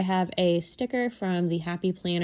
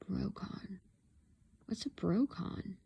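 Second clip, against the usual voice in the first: puzzled mutter; 11 dB quieter, slower than usual. Real, not acted. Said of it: "Well, that's puzzling".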